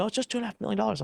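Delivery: fast, high flippant mimicry; imitating a breezy onlooker; mocking dismissive quote. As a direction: rattle it off the way careless people say it. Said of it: "people tell me".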